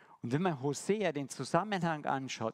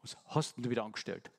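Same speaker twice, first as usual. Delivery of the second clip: lower, fast, faintly scolding; imagined reproach, lower and quicker; mock scolding; 4 semitones lower than usual. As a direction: play a God who nags his wayward child. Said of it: "das nächste Mal sagt er".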